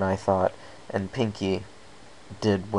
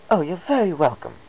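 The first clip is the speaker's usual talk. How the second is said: refined, higher lady voice; a hint of an elegant fashionista; lightly voiced Rarity; 10 semitones higher, 4 dB louder than usual.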